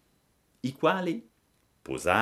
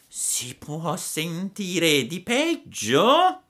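slow, raised fox voice; fox's false dismay; 12 semitones higher, 5 dB louder, much slower than usual. Acted. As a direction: sigh at the world's wickedness, sly. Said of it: "disse la volpe". (la volpe finge sgomento, voce lenta)

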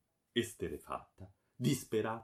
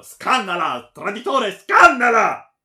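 very loud, high, rising roar; frenzied shouting; 13 semitones higher, 21 dB louder than usual. A narrator's reading. Baron ordering his henchman to kill her, shouting. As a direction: bellow the order, climbing in fury. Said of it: "cominciò a gridare al bravo".

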